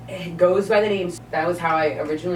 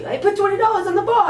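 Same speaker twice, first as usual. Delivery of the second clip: much higher, protesting stoner voice; whiny stoner; 4 dB louder than usual. Retold or imitated. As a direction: squeak out a lame excuse. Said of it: "High-pitched voice and everything".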